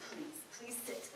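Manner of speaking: hushed whispered invitation; soft breathy whisper. Acted. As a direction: whisper so the baby sleeps. Hushed so baby stays asleep.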